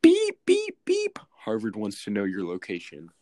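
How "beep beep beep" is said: very high, loud imitated alarm beeps; loud high mock beeping. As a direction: beep like a warning siren. Mock alarm cutting off friend.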